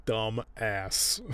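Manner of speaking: usual speaking voice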